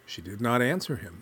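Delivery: ordinary delivery, nothing marked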